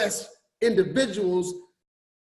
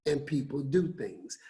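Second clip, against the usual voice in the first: low, much softer afterthought; quiet; 7 semitones lower, 9 dB quieter than usual. Real, not acted. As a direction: drop to a low, soft aside. Soft punchline after emphatic lines.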